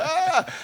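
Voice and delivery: high, loud startled yelp; panicked yelp. Retold or imitated. Musician startled before a take, high panicked yelp. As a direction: yelp as if the take just blew up. And people go.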